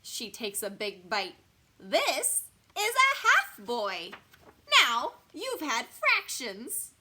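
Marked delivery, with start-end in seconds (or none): 1.8-6.9 high, loud, slow monster teacher; hammed-up gloating demonstration; smirking. A narrator's reading. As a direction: drive the lesson home with slow relish. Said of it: "she smirks".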